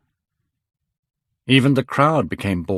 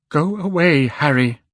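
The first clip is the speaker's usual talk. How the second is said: higher and louder, pushing him away; anguished cry; 6 semitones higher, 5 dB louder than usual. A narrator's reading. Dorian sending his friend away, a pained cry. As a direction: cry out in hurt. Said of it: "cried the boy".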